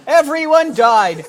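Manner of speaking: normal talking voice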